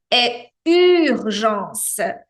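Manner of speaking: urgência bem lenta, com o U francês de biquinho; pronúncia francesa lenta. Shown as a demonstration. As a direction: articule urgência devagar com os lábios em bico. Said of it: "É o biquinho do francês". (urgência devagar com biquinho francês)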